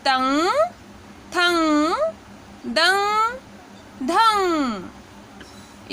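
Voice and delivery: तंग थंग दंग धंग one by one, nasal ng clear, धंग drawn out; teaching demo. Shown as a demonstration. Teacher repeating the list for students. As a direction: model each for learners. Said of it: "किस तरह से उच्चारण करना है".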